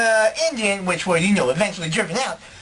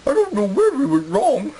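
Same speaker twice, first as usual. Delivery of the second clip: higher comic colonist voice; put-on interviewee voice; 4 semitones higher, 4 dB louder than usual. Second interviewee laments the lost colony, high comic voice. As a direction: lament it in an odd, raised character voice.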